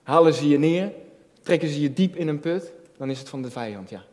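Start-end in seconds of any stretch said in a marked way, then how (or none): none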